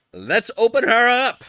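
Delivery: high, loud and drawn-out cheer; eager unboxing. Real, not acted. About to unbox the kit.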